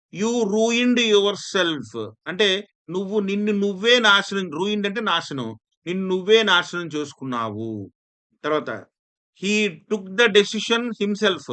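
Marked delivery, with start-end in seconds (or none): 0.1-1.9 slow, louder and higher classroom reading; slow loud example reading. Shown as a demonstration. Teacher reading example sentence. 9.4-11.5 slow, louder and higher classroom reading; slow loud example reading. Shown as a demonstration. Teacher reading example sentence.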